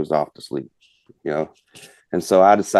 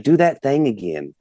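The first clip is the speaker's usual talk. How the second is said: higher, slow imitated child request; little girl; 6 semitones higher than usual. Daughter asking father for the turkey cluck, child's plea. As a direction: mimic a kid asking for help. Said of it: "she was like".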